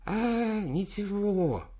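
put-on high, slow toothless old man; toothless mumble. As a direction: mumble through no teeth. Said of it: "прошамкал председатель".

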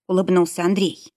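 ordinary delivery, nothing marked

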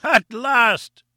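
triumphant cry. A narrator's reading. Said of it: "he cried".

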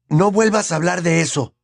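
put-on tense boyish threat; threatening. A narrator's reading. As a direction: warn him off, pitched high. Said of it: "lo amenazó Harry".

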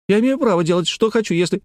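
put-on high-pitched child asserting rights; indignant. A narrator's reading. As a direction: protest like an offended little girl. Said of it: "возмутилась Лекси".

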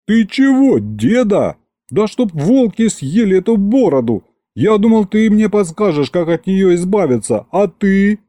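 high-pitched indignant outburst. Acted. Grandson to grandfather who won't help with the beard, indignant. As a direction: protest in exasperated disbelief. Внук возмущается дедом.

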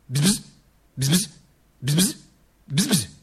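short imitated insect buzzes; fly buzzing done with the mouth; buzzing fly noise. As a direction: buzz like flies, in separate bursts.

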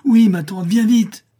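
high, loud urgent call; frightened cry. A narrator's reading. Frightened boy hurries his aunt along, urgent cry. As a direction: shout for help in alarm. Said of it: "effrayé ... et s'écria".

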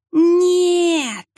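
high drawn-out eerie howl on one note; wailing howl. Acted. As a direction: howl it long on a single note. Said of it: "выла она на одной тоскливой жуткой ноте". (тоскливый вой на одной ноте)

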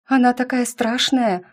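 put-on high-pitched scared girl; a touch of alarmed whining; frightened. A narrator's reading. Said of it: "Испуганно сжавшись".

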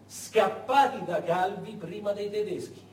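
usual speaking voice

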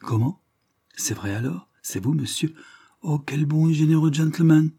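rising into an overjoyed exclamation; an old woman suddenly delighted by a coin; old beggar woman. Acted.

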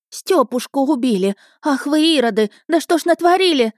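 high-pitched woman's lament; horrified outcry. A narrator's reading. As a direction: wail and scold the boys in horror. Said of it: "ахнул женский голос за спиной". (испуганные причитания женщины, высоким голосом)

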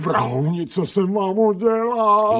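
high, loud cry of betrayal; desperate accusation. Acted. Dog crying out at the surgeons, desperate and loud.

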